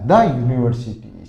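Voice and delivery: द यूनिवर्सिटी with short "the", not dee; model answer. Shown as a demonstration. Teacher to class correcting the university example. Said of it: "तो इसको क्या बोलोगे".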